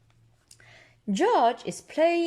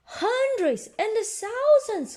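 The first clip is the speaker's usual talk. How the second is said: higher, slow excited exclaim; excited cry; 11 semitones higher, 4 dB louder than usual. Acted. Peppa amazed by pouring batteries, high excited cry. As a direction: gasp it out in wonder. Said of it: "cries Peppa".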